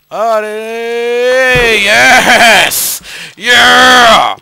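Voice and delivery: loud, high victory yells; a real burst of triumph, loud and high; excited shout. Real, not acted. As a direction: cheer the jump like you won. Triumphant landing in parkour game.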